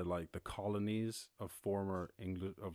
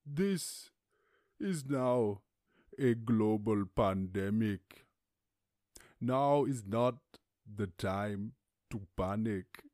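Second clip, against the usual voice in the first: slow, measured, louder official statement; WHO head imitation; 5 dB louder, much slower than usual. WHO head addressing the world, slow and grave. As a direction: announce the pandemic gravely, word by word. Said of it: "the way he talks is so excellent".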